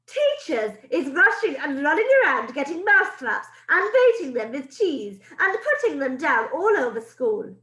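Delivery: put-on loud, high villain rant with rolled accent; Grand High Witch gloating to her audience; high, loud witch with a foreign accent; witch's accent. Grand High Witch continues speech.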